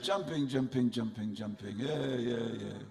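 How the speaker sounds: light French accent, laughing mockery. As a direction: tease the chanting like a sneering Frenchman. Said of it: "in French".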